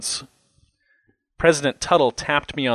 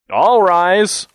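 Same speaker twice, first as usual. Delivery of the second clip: loud, high courtroom call; ringing official command, raised and projected; bailiff's order; 9 semitones higher, 9 dB louder than usual. A narrator's reading. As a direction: call the whole court to its feet. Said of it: "ordered the bailiff".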